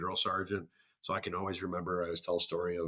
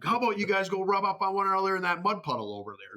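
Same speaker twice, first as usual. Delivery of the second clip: mocking drill sergeant; 11 semitones higher than usual. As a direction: taunt them like a drill sergeant.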